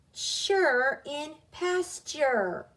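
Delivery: "sure" said slowly as CHER, as in pasture; pronunciation demo. Shown as a demonstration. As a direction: model "sure in pasture" with ch plus er. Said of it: "This is pronounced chure in pasture".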